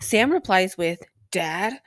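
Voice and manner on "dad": lightly voiced questioning son; a short impression, voice lifting into a question; Sam. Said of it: "That's my Sam impression".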